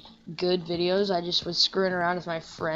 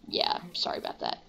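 whisper; 7 dB quieter than usual. Real, not acted. Apologising to viewers aside.

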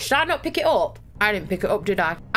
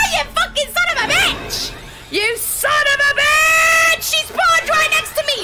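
shocked shout; 6 dB louder than usual. Real, not acted. Jump scare by animatronic.

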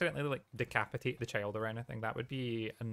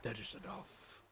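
a softer, lightly lowered stern god; a hint of a gruff war god, held back and quiet; Kratos voice; 4 semitones lower, 11 dB quieter than usual. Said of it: "I wish I could go that deep".

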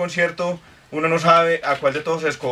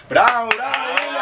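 teacher praising; 8 semitones higher than usual. Pretend.

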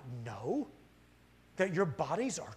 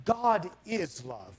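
slow emphasis; 4 dB louder, much slower than usual. Real, not acted. Slow emphatic church statement.

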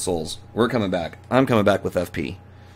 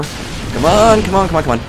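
urging; 7 semitones higher, 4 dB louder than usual. Real, not acted. Urging during fight.

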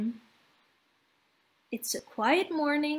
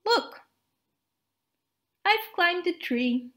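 higher, bright-spirited snowman voice; a higher voice for the proud snowman; cheerful snowman; 5 semitones higher than usual. Acted. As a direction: play the eager little snowman showing off. Narrator voices Olaf.

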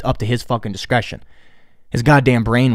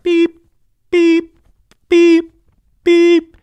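high, loud beeping; heart monitor beeps; 7 dB louder, much slower than usual. Retold or imitated. Imitating the monitor he expected, high beeps. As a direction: beep like a monitor, clipped and high.

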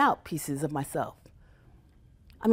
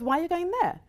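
baffled friend; 10 semitones higher, faster than usual. Retold or imitated. Friend questioning the trip.

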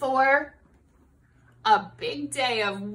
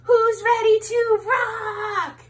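higher, louder and slow stage shout; rock star shout; 8 semitones higher, 5 dB louder, slower than usual. Acted. Sheep shouting to the crowd before the show, hammed-up rock shout. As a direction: hype the crowd like a frontman. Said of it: "Sheep asks the crowd".

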